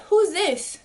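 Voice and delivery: high, startled who's this; surprised question. Retold or imitated. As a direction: catch sight of the girlfriend, shocked. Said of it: "I'm like".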